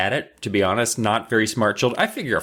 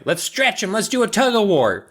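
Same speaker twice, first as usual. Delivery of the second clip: high, excited mock gnome voice; eager gnomes; 9 semitones higher than usual. Gnomes proposing tortures for the kids, high eager voice. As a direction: pitch it up like keen little schemers.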